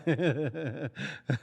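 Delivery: forced awkward chuckle; fake laugh. Boy fake laughing along with the class, forced. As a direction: laugh it off to hide the hurt. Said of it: "I'm fake laughing".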